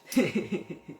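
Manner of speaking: soft low chuckling; a quiet laugh to herself; laughter. Real, not acted. Boss laughing softly at her own rant.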